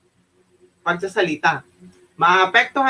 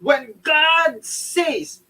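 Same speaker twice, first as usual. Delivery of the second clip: high, slow, commanding proclamation; powerful preaching voice; 7 semitones higher, much slower than usual. Shown as a demonstration. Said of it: "you need to have your power".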